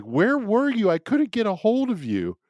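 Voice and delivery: high, urgent retold relief; elated wife. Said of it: "elated, essentially".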